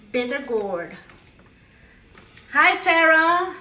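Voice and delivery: ordinary talk, nothing marked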